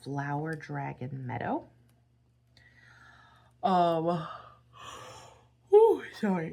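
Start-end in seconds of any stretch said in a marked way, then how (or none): 3.6-6.5 mid-yawn. Real, not acted. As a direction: talk through a yawn. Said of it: "another yawn".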